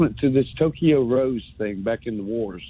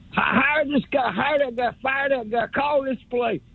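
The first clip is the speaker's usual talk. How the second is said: higher, rattled-off orders; mock armchair GM; 11 semitones higher than usual. Mocking callers who play general manager, higher and brisk. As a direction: bark it like a fake boss.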